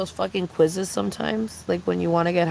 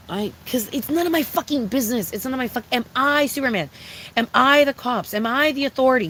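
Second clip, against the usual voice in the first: indignant; 6 semitones higher than usual. Real, not acted. Rising rhetorical questions.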